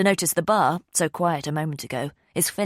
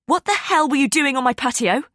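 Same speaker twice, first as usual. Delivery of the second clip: loud, high furious yell; 9 semitones higher, 6 dB louder than usual. Acted. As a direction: shout it across the table. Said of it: "I yell".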